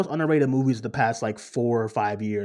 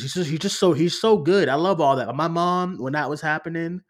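ordinary talk, nothing marked

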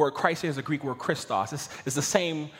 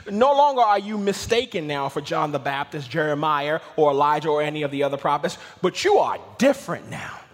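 loud, driving list into a proclamation; full-force preaching; 3 semitones higher, 8 dB louder than usual. Real, not acted. Preacher sets Jesus apart from the prophets, full-force preaching. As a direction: hammer out each name, then lift the contrast.

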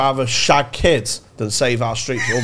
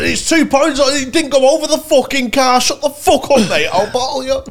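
higher, louder furious yelling at a mate; angry shout; 6 dB louder than usual. Retold or imitated. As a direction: bellow it like you're squaring up for a fight. Acted row over a game.

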